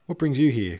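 loud, high-pitched greeting; cried out. A narrator's reading. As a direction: call out in surprise to a newcomer. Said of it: "cried the officers".

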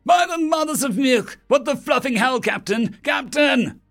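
very high, panicked cry over the radio; terrified screaming. A narrator's reading. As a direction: scream for the captain in terror. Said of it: "terrified screaming filled our radios".

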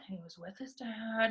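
a murmured repeat to yourself; quiet repeat. Real, not acted. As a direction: drop it to a soft aside.

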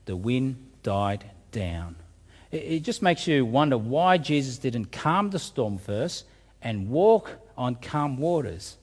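ordinary delivery

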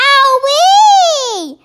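high, drawn-out storybook yell; pained yelp. A narrator's reading. BFG with Sophie in his ear, high pained yell. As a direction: yelp as if your ear hurts. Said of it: "yelled the B F Gs".

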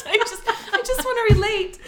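high, tearful laughing plea; words squeezed out between giggles. Real, not acted. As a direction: whine it through laughter. Still laughing at failed accent.

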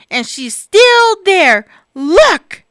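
slow, loud whiny cub; grumbling Baby Bear. Acted. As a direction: whine and point, fed up. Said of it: "grumbled Baby Bear".

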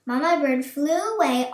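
ordinary delivery

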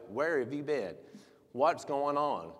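slow, weighty mock scolding; mock berating. Pastor showing how not to greet those astray, mock berating. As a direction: demand an explanation, imitating a scolding. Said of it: "and berate them".

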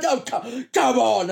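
imitated jeering outburst; obnoxious heckler. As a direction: bellow it like a show-off in the cinema. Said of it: "deliberately being obnoxious and loud".

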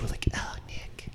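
swooning whisper. Retold or imitated. Imitating swooning fans.

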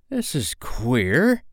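slow, high, bewildered pirate voice; gasped. A narrator's reading. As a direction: draw it out in puzzled amazement. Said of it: "Smee gasped".

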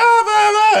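high, silly blah blah; mock your own squeaky voice; high chatter. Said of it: "my voice is always like".

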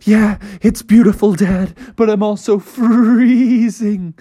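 put-on a frog child too cold to care; shivering kid frog. Family answers father, far timbre.

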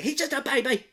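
high squealed protest; mock hysterical mother. Mother hitting the man to protect her son, mock hysterical. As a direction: wail it in hammed-up outrage.

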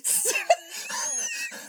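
high squeaky wheeze laugh; helpless wheezing laughter. Real, not acted. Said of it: "I'm Wheezy Jefferson over here".

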